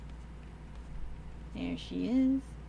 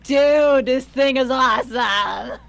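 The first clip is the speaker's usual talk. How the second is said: excited shout; 3 semitones higher, 8 dB louder, slower than usual. Retold or imitated. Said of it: "Being like".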